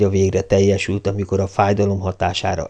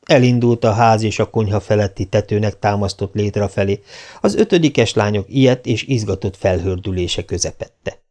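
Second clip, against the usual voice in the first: ordinary delivery, nothing marked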